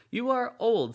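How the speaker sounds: put-on young man voice. A narrator's reading. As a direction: raise the pitch for the youth.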